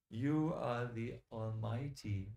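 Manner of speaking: usual speaking voice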